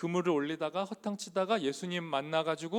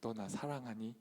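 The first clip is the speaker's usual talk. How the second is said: soft question; 5 semitones lower, 12 dB quieter than usual. Acted. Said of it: "그 이름을 부르면서 물어요".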